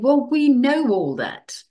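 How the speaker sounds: high, loud, fed up but laughing; we've heard it all before; a touch of weary exasperation; exasperated. Real, not acted. Exasperated at repeated advice.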